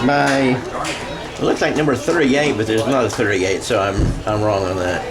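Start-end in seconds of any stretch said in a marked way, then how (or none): none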